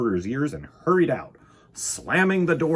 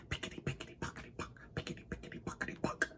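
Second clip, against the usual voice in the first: soft rhythmic whispered chant; whispered wheels; 17 dB quieter, much faster than usual. A narrator's reading.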